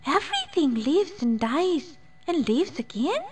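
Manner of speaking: high, awed wee question; wondering fairy. A narrator's reading. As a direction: ask in amazed wonder. Fairy voiced.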